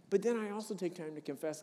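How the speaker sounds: ordinary talk, nothing marked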